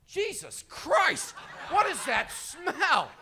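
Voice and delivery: slow, loud, high hallway bellow of disgust; hammed-up stranger recoiling at the stink; mock disgusted yell. Stranger in hallway smells skunk, slow mock yell. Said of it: "people walking down the hallway going".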